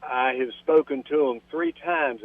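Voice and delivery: ordinary delivery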